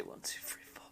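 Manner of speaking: real whisper to oneself, working it out; whispered tally; whispered counting. Real, not acted. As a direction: count under your breath. Counting squares to oneself before moving.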